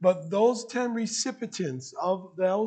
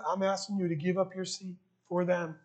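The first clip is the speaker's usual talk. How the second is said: normal talking voice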